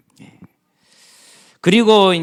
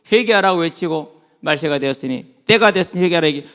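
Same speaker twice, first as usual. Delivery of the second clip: usual speaking voice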